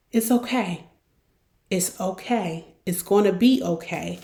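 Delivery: calm the worried one down gently; reassuring. Shown as a demonstration. Soothing an over-apologizer.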